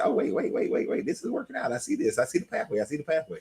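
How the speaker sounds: high, hushed burst of realization; excited realization. Retold or imitated. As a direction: replay a sudden idea dawning, quick repeated words. Replays own sudden realization.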